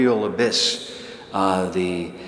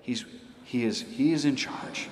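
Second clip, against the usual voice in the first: ordinary talk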